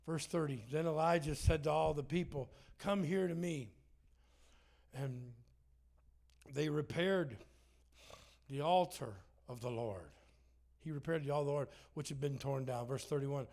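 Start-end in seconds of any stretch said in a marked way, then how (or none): 0.7-10.1 a lightly raised, louder Bible reading; scripture reading. Acted. Pastor reading First Kings to the church, in a raised reading voice. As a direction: read the verse out to the congregation.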